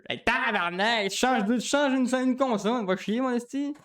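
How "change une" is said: ordinary talk, nothing marked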